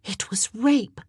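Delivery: tense, outraged verdict; angry hiss. A narrator's reading. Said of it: "hissed Elizabeth Grey".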